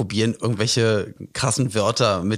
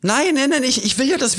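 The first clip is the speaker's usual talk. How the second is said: flustered protest; 13 semitones higher than usual. Real, not acted. Protests, can't find button.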